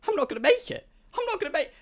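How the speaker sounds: high-pitched acted panic; panicking. Traveler realizing he may miss the convention, panicked. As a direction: voice jumps up high as panic sets in. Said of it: "I start panicking".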